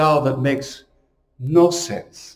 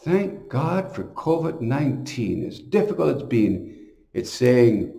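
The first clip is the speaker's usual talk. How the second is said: ordinary delivery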